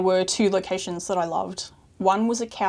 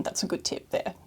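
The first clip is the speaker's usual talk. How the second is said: quiet aside; 8 dB quieter than usual. Real, not acted. Aside remark.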